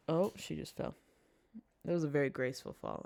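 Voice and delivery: ordinary talk, nothing marked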